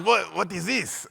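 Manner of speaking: baffled question in thick Hungarian English; Hungarian accent. Retold or imitated. Hungarian teacher finding a painting portfolio, puzzled accented question. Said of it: "thick accent".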